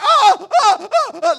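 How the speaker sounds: loud, high hammed-up sobs; mock bawling. Dad imitating himself bawling in the barber's, hammed-up wailing. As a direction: wail in big comic bursts. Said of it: "I started properly like".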